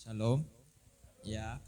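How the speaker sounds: ordinary talk, nothing marked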